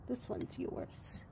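slow, soft unvoiced whisper. Real, not acted. Owner haltering her sleepy horse, slow whisper.

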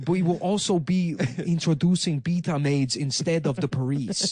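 mock foreign-accented politician; Andrew Yang. Presidential candidate announcing a giveaway, mock foreign accent. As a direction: announce policy in a put-on broken-English accent. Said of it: "learn English, Yang".

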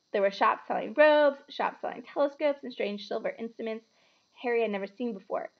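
usual speaking voice

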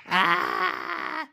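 high sustained scream. Pretend. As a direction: shriek as you get caught. Killed again in game.

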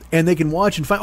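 normal talking voice

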